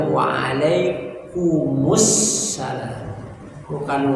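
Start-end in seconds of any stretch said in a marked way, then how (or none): 0.1-3.0 "Waalaikumsalam" stretched out very slowly; very slow reply. Shown as a demonstration. Teacher models the reply very slowly. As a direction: draw out "Waalaikumsalam" syllable by syllable. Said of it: "Maka jawabnya".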